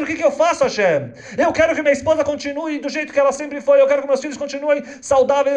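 high, loud pleading sermon voice; impassioned congregant crying out to Hashem; fervent preaching. Acted. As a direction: preach it as a worried father before Yom Kippur.

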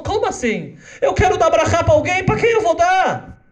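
put-on a startled child asking; little girl voice. (menina surpresa, aguda e alta)

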